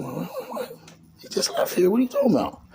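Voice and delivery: very high, squeaky-pitched disbelief; stunned disbelief. Retold or imitated. Narrator hearing Duke was arrested, high stunned disbelief. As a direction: act out being floored by the news.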